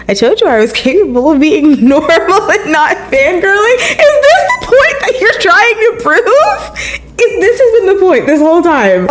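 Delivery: high, rising squeal of disbelief with laughter; incredulous outburst. Real, not acted. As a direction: build to a squeaky, laughing protest. Exasperated outburst at the character's point.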